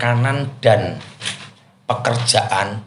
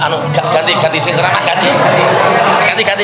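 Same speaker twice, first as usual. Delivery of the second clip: joking shout; 8 semitones higher, 5 dB louder than usual. Real, not acted. Calling for speaker change, joking.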